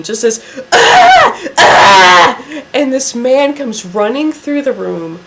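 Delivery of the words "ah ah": two sharp high yelps of a scream; imitating a panicked man's screams; mock screaming. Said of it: "we hear this screaming".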